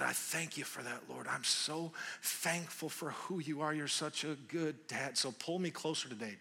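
soft, intimate prayer; spoken prayer. Real, not acted. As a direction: pray aloud gently and sincerely. Prays aloud.

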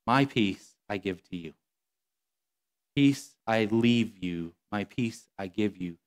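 tender, unhurried scripture reading; slow hushed reading. Acted. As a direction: let the promise settle gently. Slow reverent rereading of verse.

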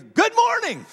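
high, booming cheerful greeting; really loud greeting. Retold or imitated. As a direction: belt it out like an overeager store worker. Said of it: "that was really loud".

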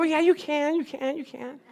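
high-pitched mock pleading; wheedling insistence. Pastor urging Marianne to take the gift back, wheedling.

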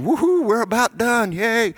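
high, playful woohoo of relief; a sudden high, happy whoop; mock cheering. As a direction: cheer like a study group glad to be done. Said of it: "and they're all saying".

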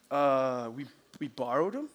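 lightly acted guilty boy, low and halting; sheepish excuse. Boy caught with stolen Cokes, mock sheepish.

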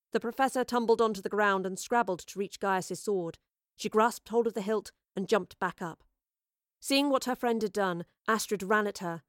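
ordinary delivery

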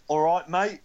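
bad attempt at English accent; Andre Reed. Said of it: "the worst attempt at an English accent".

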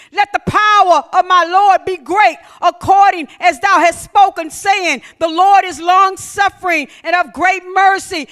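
loud forceful scripture reading; preaching scripture. Acted. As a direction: proclaim the verse with power.

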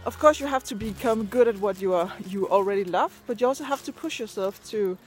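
normal talking voice

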